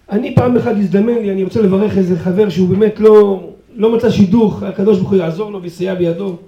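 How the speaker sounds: normal talking voice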